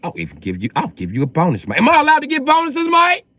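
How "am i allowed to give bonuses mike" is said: mock boss question. Asks Mike in put-on voice.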